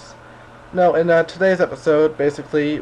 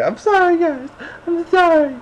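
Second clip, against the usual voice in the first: much higher, squeaky mock apology; 3 dB louder than usual. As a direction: squeak out a cartoonish sorry. Playful apology, squeaky repeats.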